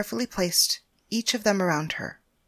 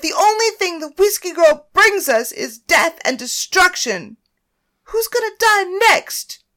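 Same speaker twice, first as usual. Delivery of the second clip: much higher, louder, near-crying rant; screaming through tears; 11 semitones higher, 9 dB louder than usual. Acted. Naomi raging at everyone about Paris, screaming in tears. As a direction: rage and weep at once.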